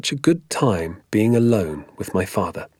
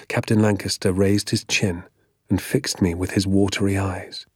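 ordinary delivery

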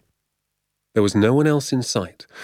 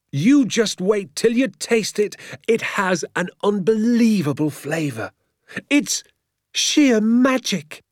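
high, drawn-out rapture; excited cry; 12 semitones higher, slower than usual. A narrator's reading. Father promising his son a wondrous taste, excited cry. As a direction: build to an awestruck, stretched finish. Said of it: "he cried".

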